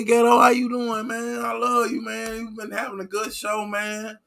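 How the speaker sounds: overjoyed viewer greeting his favorite streamer; gushing mock fan. A streamer playing a fan who missed his channel, high and gushing. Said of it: "y'all supposed to be like".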